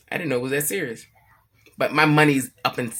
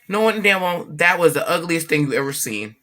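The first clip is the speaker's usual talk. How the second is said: ordinary talk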